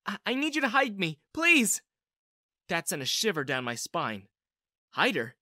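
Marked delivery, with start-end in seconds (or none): 0.1-1.8 high, stammered plea rising on "please"; frantic entreaty, pitched well up; desperate begging. A narrator's reading. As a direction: plead urgently to be hidden. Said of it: "begged Sarita".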